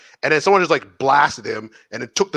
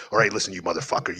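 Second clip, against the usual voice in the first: low, softer mock threat; lightly voiced scammer; 7 semitones lower, 7 dB quieter than usual. Scammer losing patience with a stalling victim, mock menacing. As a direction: drop your voice and play the threatening caller. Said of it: "I just wanna see what he says".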